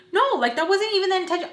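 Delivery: high, fast, half-laughing denial; frantic protest. Retold or imitated. Retold frantic protest in quarrel.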